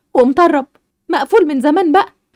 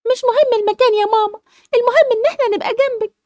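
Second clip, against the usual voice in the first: a daughter consoling her mom; childlike tearful comfort; young daughter; 10 semitones higher than usual. Acted. Daughter reassures her mother.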